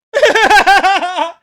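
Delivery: a loud burst of incredulous laughter; disbelieving laugh. Real, not acted. Laughs at being told he's dead.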